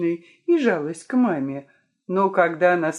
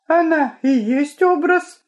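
high, louder eager cry; exclaiming; 9 semitones higher, 5 dB louder than usual. A narrator's reading. Inga insists Lyolya is the right type, eager exclamation. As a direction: burst out in her friend's favour. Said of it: "воскликнула Инга".

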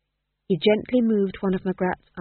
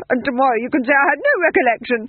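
frantic duke; 6 semitones higher, 8 dB louder, faster than usual. Acted. Duke rehearsing excuses.

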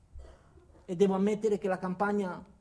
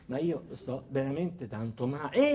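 lower, slow, feeble complaint; imitating a sick man pleading he really feels bad; frail patient insisting, mildly; weak sick man; 7 semitones lower, slower than usual. Sick member protests weakly.

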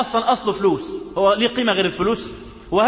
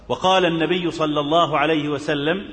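normal talking voice

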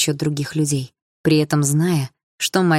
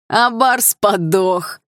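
crowd jeer; 5 semitones higher, 4 dB louder, much slower than usual. Acted.

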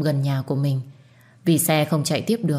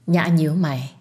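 ordinary delivery

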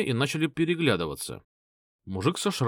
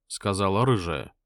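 plain reading voice

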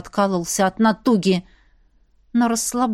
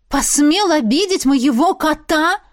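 indignant; 5 semitones higher, 3 dB louder than usual. Acted. Heroine's angry thought.

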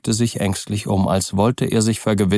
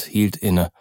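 ordinary delivery, nothing marked

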